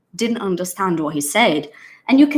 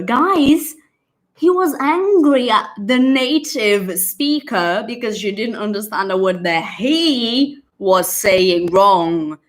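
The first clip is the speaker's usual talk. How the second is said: ordinary talk, nothing marked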